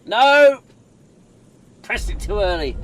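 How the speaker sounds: high, loud frustrated no; shout. Real, not acted. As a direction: cry out in annoyance. Pressed button too early.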